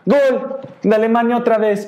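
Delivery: high-pitched mom calling out a goal; imitated mom. Mom heard from his room announcing Germany's goal, high-pitched cry. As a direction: cry out the news as mother, very high. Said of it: "solo escucho a mi mamá".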